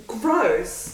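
drawn-out, exaggerated disgust; disgusted reaction. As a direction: stretch the word out in disgust. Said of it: "I'm like".

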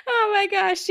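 high, loud words through laughter; laughing. Real, not acted. Host reacting to her cohost's cooing over Archie, laughing. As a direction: crack up while speaking.

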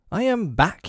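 high-pitched, mildly heated; hot indignation. A narrator's reading. Peter annoyed at silent boys, mildly hot. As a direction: announce it high, a touch indignant. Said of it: "he said hotly".

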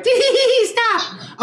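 put-on high giggling robot girl; mock-girlish giggle begging to stop; giggly feminine robot. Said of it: "she's like".

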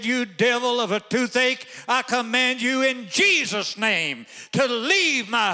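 loud, high, slow commanding rebuke; voice of authority. Retold or imitated. Wife rebuking the toothache for her husband, thundering command. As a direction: command the devil to leave, full force. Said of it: "she lifted up her voice with authority".

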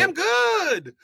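high, loud and jolly; happy Supreme Master. Acted. Supreme Master tells his recruit he is fine, jolly and high. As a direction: beam like a man with no care. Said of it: "Because the Supreme Master, I picture him, he's just a happy".

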